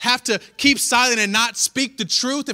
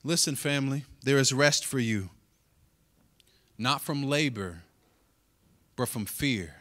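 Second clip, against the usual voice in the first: soft pastoral appeal; 5 semitones lower, 5 dB quieter than usual. Acted. Tender pastoral aside.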